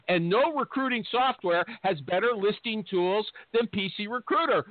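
ordinary talk, nothing marked

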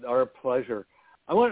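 normal talking voice

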